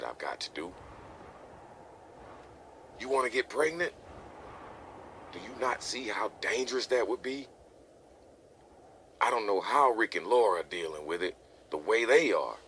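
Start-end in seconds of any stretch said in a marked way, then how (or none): 3.0-12.6 exasperated father, raised pitch and volume; angry parental scolding. Acted. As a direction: lecture the young couple, building in force. Father lectures young couple about risks.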